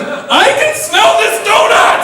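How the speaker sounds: high, loud, slow joyful shout; shouting with delight at a donut; triumphant proclamation. Retold or imitated. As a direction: announce it to the whole office. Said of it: "and I proclaimed".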